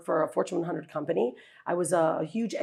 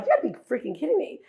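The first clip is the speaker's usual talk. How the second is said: disbelief; 8 semitones higher, faster than usual. Retold or imitated. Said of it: "And he's like".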